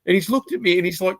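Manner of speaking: ordinary delivery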